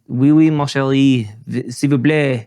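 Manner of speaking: mock Frenchman in a French accent; French impression. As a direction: toss off a cheesy French cliché in a hammed-up French accent. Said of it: "on the spot French impression".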